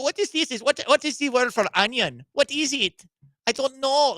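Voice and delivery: high, groping fake Spanish accent; mock Spanish accent. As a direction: play clueless in a put-on Spanish accent. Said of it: "that's what she's doing".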